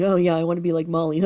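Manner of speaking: loud mock gushing with a cackle; scornful impression ending in forced laughter; mocking imitation. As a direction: mimic her loudly, tack on a fake laugh. Mocks rival's speech and laugh.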